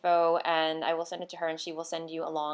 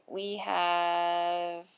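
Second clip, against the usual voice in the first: ordinary talk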